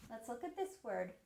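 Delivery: ordinary talk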